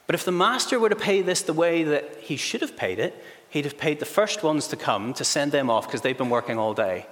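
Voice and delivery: ordinary talk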